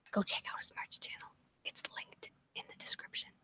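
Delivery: quick whisper, voice dropped away; whispered aside. Real, not acted. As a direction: slip the plug in as a whisper. Plugging friend's merch aside.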